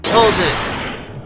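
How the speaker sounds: loud, high courtroom shout; shouted objection. Acted. Defender interrupting witness in cross-examination, shouted objection. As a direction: cut the witness off with a big shout.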